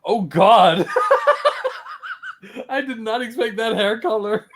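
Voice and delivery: helpless laughter breaking up the words; laughing. Real, not acted. Reacting to friend's old photo, laughing in surprise.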